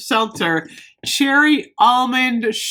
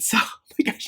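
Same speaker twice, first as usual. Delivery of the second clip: breathy laugh; 6 dB quieter than usual. Real, not acted. Laughing breathlessly about soap.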